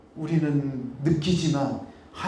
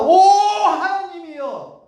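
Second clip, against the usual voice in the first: slow, high, tearful wail of "오 하나님이여"; desperate cry; 11 semitones higher, 5 dB louder, much slower than usual. Acted. Mother crying to God for her child, tearful wail. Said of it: "그 상황에서 외칩니다".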